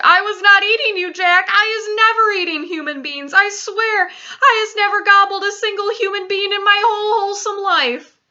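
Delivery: high, loud, wailing protest; screeched denial. A narrator's reading. Giant swearing innocence to Jack, screeched protest. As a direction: screech your innocence in panic. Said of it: "he screeched".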